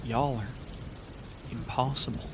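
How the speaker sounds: soft, halting words with a long pause; hushed awe. Acted. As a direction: say it quietly, as if stunned. Hushed awe after the battle.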